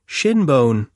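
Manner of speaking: eager. Acted. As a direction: jump in eagerly with a smile. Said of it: "said with an eager smile".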